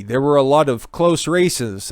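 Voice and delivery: ordinary delivery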